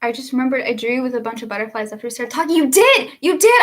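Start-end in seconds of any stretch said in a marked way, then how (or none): 2.6-3.6 higher and louder, bursting; excited shout to a friend who remembers. Real, not acted. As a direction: blurt it out in delight. Excited recall of shared memory.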